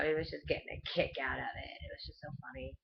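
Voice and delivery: whispered, fast aside. Real, not acted. Confiding to viewers about dad in a whisper. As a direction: drop to a whisper to share it.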